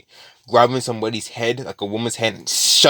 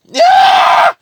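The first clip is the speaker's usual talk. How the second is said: shrieking, much higher and louder yell; sudden shout; 11 dB louder than usual. Real, not acted. Storyteller erupting mid-story, excited shriek. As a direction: burst out with excitement.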